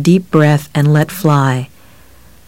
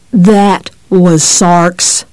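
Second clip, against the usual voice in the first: slow menacing; much slower than usual. A narrator's reading.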